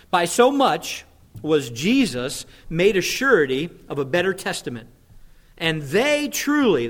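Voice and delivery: slow, emphatic reading with weighty pauses; slow scripture reading. Real, not acted. Pastor reading Hebrews aloud to the congregation, slow and emphatic. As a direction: read scripture out slowly and forcefully. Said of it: "just gonna read it slowly".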